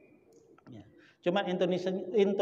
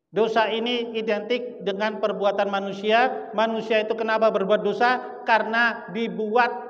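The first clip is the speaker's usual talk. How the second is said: slow, raised, forceful sermon delivery; preaching; 6 semitones higher, 6 dB louder than usual. Acted. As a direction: drive the lesson home to the congregation, stretching phrases. (berkhotbah lantang dengan tempo lambat)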